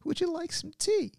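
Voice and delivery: put-on very high, frail old lady offering tea; sweet, quavery granny offer; grandma offering tea, very high and sweet. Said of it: "this is a grandma".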